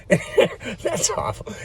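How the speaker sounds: slow words through laughter; laughing. Real, not acted. Laughing at a crude joke, words breaking up. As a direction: laugh it out, barely voiced.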